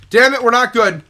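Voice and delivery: loud, high-pitched burst of panic; sudden loud curse of alarm; alarmed shout. Real, not acted. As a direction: yell as danger suddenly hits. Sudden danger in game.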